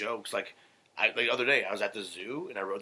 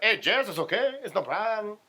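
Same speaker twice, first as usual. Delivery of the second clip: loud, very high accented imitation; hammed-up singsong accent, reassuring someone; Ramon; 11 semitones higher, 6 dB louder than usual. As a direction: mimic a friend's foreign accent, pitched way up. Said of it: "this is how he's talking".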